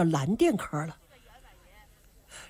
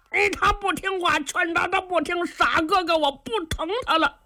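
put-on high, childlike dopey voice of the simple lad; simple-minded boy; 11 semitones higher than usual. Simple lad tells the squire he brought the stranger, high and childish. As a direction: play the naive giant talking like a child.

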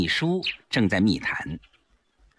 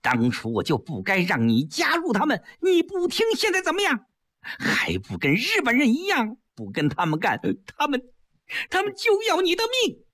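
high, contemptuous wife's scolding; high-pitched scorn for a weak husband; scornful scolding; 13 semitones higher than usual. Acted. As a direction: spit the words at him. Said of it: "季嫣然啐了他一口".

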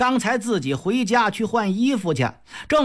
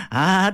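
put-on drunken roar. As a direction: bellow before the threat. Jia Lian roars.

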